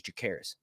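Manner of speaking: Jacaerys said as "jə-KAIR-is"; Jacaerys, softly, as the narrator says it; audiobook pronunciation. Shown as a demonstration. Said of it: "how the narrator says it".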